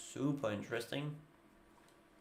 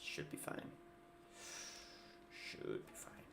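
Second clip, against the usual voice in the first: hushed self-reassuring murmur; whispered to self; 15 dB quieter than usual. Real, not acted. After a possible misplay, reassuring himself: whispered murmur. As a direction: talk yourself down quietly, under your breath.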